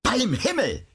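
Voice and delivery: high-pitched exclamation of lost patience; impatient, high angry outcry; enraged cry. A narrator's reading. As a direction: burst out high, without added volume. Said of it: "schrie er".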